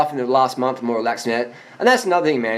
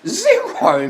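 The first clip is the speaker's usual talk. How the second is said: high laughing repeat. Real, not acted. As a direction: laugh the word Zero out high. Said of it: "repeats answer laughing".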